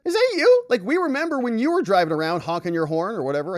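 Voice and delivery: high, loud teasing friend voice; mock the friends' gossip; hammed-up high voice of gossiping friends. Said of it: "They said".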